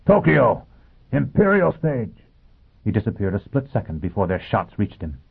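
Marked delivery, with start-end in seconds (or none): 0.0-2.2 loud, high destination call; raised call, drawing out the last phrase; Foyle shouting. A narrator's reading. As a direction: shout the stop out to pursuers. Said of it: "he shouted".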